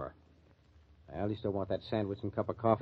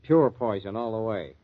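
louder, bitter condemnation; bitter outburst; 2 semitones higher, 9 dB louder than usual. Acted. Mike tells Andy what he thinks of the actress, bitter and loud. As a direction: spit out your contempt for her.

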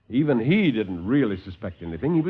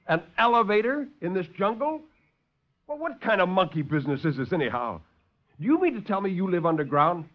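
higher, agitated protest; indignant disbelief; 6 semitones higher than usual. Acted. Lieutenant challenges the doctor, higher and indignant. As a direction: bristle at the absurd idea.

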